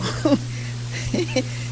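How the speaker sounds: short breathy laugh; a quick amused laugh under the breath. Real, not acted. Laughs after imam's answer.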